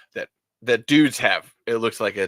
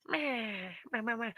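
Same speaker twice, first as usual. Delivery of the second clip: squeaky toy imitation; 9 semitones higher, 14 dB quieter than usual.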